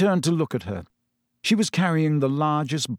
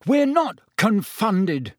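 half-voiced roar, high and slow; high, emphatic protest, mildly roared; 8 semitones higher, 3 dB louder, slower than usual. A narrator's reading. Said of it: "Harry roared".

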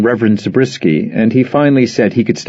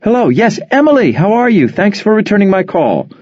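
much higher, sugary telephone politeness; fake sweet phone voice; 11 semitones higher than usual. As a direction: switch on the company charm for the caller.

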